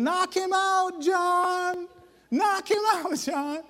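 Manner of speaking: high, loud mock holler; calling up. Friends down below urging John on, hammed-up calling. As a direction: holler it up to someone in a treetop.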